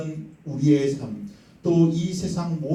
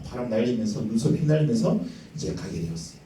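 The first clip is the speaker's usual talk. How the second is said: normal talking voice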